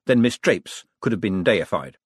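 ordinary delivery

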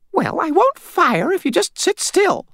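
very high, slow, squeaky grumble; querulous old lady. A narrator's reading. Old lady holding intruder at gunpoint, querulous squeak. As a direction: complain in a squeaky old-woman voice. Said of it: "she said in a querulous tone".

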